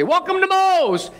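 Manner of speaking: singsong welcome at the door; cheery restaurant greeting. Retold or imitated. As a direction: greet the customer like an eager waiter. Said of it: "they say".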